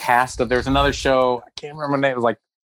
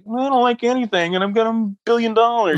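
higher, put-on voice of a sourpuss millionaire; mock sour rich guy; 9 semitones higher than usual. Teller voicing a celebrity's sour face, mock sneer. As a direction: sneer it like nothing pleases you.

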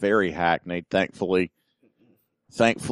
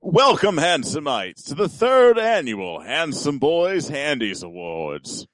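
high, booming show-host intro; put-on announcer voice; 6 semitones higher, slower than usual. Host counting in the awards show, hammed-up announcer voice. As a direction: swoop up grandly like an awards host. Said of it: "keep up that voice".

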